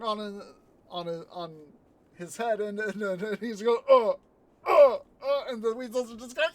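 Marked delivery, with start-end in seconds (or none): 3.9-5.0 loud, high comic grunts; imitated grunts of impact. Retelling a man hit by falling balls, mock grunts. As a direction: grunt as if bonked on the head.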